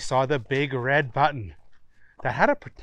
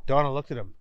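ordinary delivery, nothing marked

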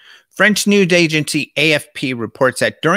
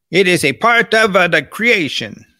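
imitating Italian speaker; 5 semitones higher than usual. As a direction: mimic how the Italian priest phrased it, lightly. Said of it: "That's how he said it. That's how the Italians talk".

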